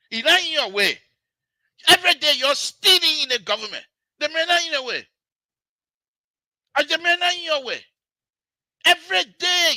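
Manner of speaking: real angry protest; indignant outburst. Real, not acted. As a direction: berate them at the top of your range. Angrily defending the former president.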